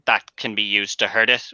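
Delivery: ordinary delivery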